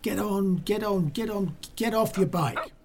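high, repetitive mock order; retold nagging. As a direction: imitate a man insisting over and over. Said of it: "he kept going".

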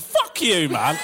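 a laughing mock shout; playful shout. Real, not acted. Comedian laughingly swears at the audience in a playful shout. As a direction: throw a jokey insult at the crowd.

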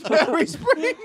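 high, loud incredulous laugh; laughing disbelief. Real, not acted. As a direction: echo it back, cracking up. Amused disbelief at the name.

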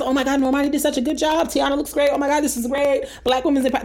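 high, quick mock gushing; gushing praise. Imitating fans praising [name]'s performance, high quick gushing. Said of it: "that's the energy I got".

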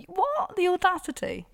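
very high, scandalised squeal; utterly scandalised; outraged disbelief. Acted. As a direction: pitch it way up in mock outrage. Outraged at the man's text.

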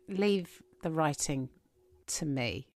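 low, slow, hushed assertion of authority; imagined powerful author. Retold or imitated. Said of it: "J K Rowling's got so much power".

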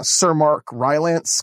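ordinary talk, nothing marked

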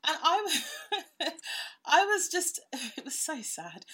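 real laughter breaking through the words; giggling, high and breathless; laughing. Real, not acted. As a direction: laugh while admitting it was sad. Laughing at her own tears.

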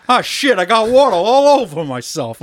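quoting the throwaway line the way he says it; mock brother-in-law's line. Friend retelling a movie line, lightly acted. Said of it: "the way he says it".

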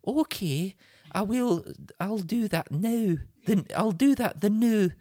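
Claire. Acted. Husband plays his wife vowing devotion, bad Scottish accent. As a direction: make a solemn vow in mock Scottish. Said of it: "that is the worst Scottish accent".

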